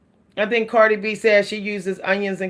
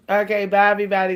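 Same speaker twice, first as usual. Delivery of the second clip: ordinary delivery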